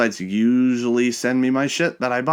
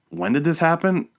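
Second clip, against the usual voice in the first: incredulous; 9 semitones higher than usual. Retold or imitated. Caught off guard by the bank.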